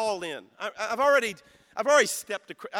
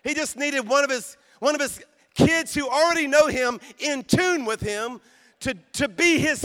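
louder, higher preaching cry; preaching shout; 6 semitones higher, 6 dB louder than usual. Real, not acted. Preacher rousing the congregation, loud and high. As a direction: drive it home to the crowd.

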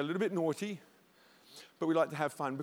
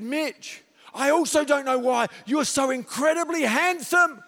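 anointed preaching voice; 8 semitones higher, 8 dB louder than usual. Retold or imitated. Preacher tells son with a sudden anointed, loud fervour. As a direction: proclaim it over your son like anointed preaching. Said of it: "then I felt the anointing kick in".

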